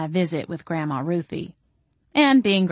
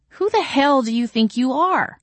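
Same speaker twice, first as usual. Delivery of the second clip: indignant shout, pitched up; crying out; 7 semitones higher, 4 dB louder than usual. A narrator's reading. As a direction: fire it back at her. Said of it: "I cried".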